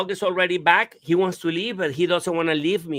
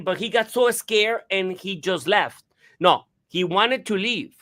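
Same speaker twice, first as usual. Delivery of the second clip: normal talking voice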